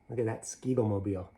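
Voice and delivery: ordinary delivery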